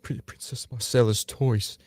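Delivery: slow, drawn-out stern northern lord; Ned voice. Shown as a demonstration. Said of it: "Best Ned voice, say".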